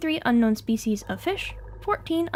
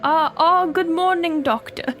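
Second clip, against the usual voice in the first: loud, higher-pitched British greeting; attempted British accent; 4 semitones higher, 5 dB louder than usual. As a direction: put on a hammed-up British lord's voice. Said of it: "Should I attempt a British accent".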